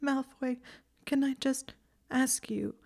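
high, whispered tearful question. A narrator's reading. Hermione still tearful, asking Malfoy something. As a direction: ask through sniffles, hesitant.

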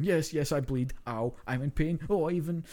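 lightly mock a wounded superhero; hammed-up Batman in pain. Podcaster mocking Batman punched by Superman, mock-pained. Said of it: "not have Batman get punched by Superman going".

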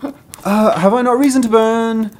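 high, hurried storybook protest; indignant garbage heap. Acted. Garbage heap answers the tree, indignant high protest. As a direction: launch into the excuse, pitched right up.

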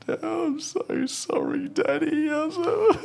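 mock child's wail, very high and drawn out; broken-down crying. Six-year-old apologises to Daddy, exaggerated tearful breakdown. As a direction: imitate a small kid sobbing an apology. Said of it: "he's just broken down".

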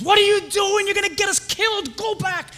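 high, loud retold yell; acted-out frantic scolding; examiner's panicked scolding. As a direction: yell in alarm at a learner driver. Acts out alarmed driving examiner.

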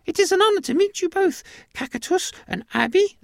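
quick, very high squeaky greeting; high-pitched little mouse voice; tiny alien mouse. Acted. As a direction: squeak a brisk formal welcome. Mouse formally greets farmer and cow.

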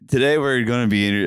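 normal talking voice

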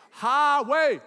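"Highway" drawn out high and loud; emphatic HIGHWAY. Real, not acted. Preacher stressing where dad stopped, loud emphatic "Highway". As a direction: punch "Highway" loudly, high falling pitch.

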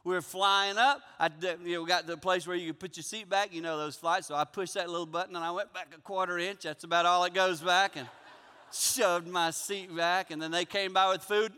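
usual speaking voice

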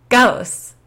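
a mocking, amused "Ghosts" with a falling end; laughing. A narrator's reading. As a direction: laugh the word "Ghosts" out in disbelief. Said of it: "Pretty Pony laughed".

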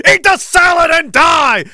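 high, loud shouted sign-off; mock shout. Reading a listener's message ending, mock shouting. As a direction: blast the last line like a battle cry.

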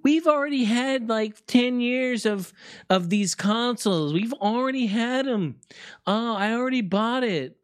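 high, sing-song mock of clueless fans; high mocking impression of smug fans. Mocking fans who own old consoles.

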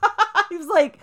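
high giggly laugh through the words. Real, not acted. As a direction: laugh while asking. Laughing retelling.

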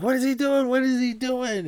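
very high-pitched mock exclamation; exasperated cornerman. Duke watching Rocky get hit, high mock exasperation.

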